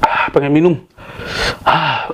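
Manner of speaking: high, strained, fighting the burn; mouth burning from spicy food. Real, not acted. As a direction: pant out words through burning chili. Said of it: "Asli ini pedas banget".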